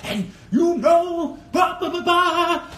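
loud, high, drawn-out preacher parody; hammed-up sermon babble, stretched out; mock pulpit preacher. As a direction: imitate a pastor booming from the pulpit. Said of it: "in the pulpit, they be like".

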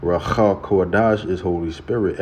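ordinary talk

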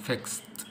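"fixed" softly said as fikst; T ending. Shown as a demonstration. Teacher explaining the ending after an S sound, T sound. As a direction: finish "fixed" on a quiet T. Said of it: "صوت الس هيبقى اتنطق ايه".

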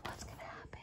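suspenseful whisper. Acted. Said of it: "suspense aside".

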